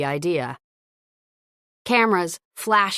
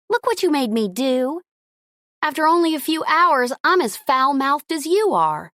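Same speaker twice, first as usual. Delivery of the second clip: higher, louder exasperated outburst; 8 semitones higher, 3 dB louder than usual. Acted. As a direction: scold him, half laughing. Outraged at her own cursing.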